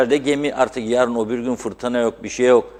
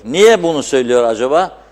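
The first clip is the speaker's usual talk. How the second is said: normal talking voice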